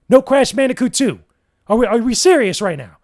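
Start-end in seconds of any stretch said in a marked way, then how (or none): none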